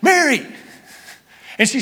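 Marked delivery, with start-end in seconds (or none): 0.0-0.5 calling Mary out high and loud; a glad cry of her name; loud joyful call. Retold or imitated. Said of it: "it expresses here with a loud voice".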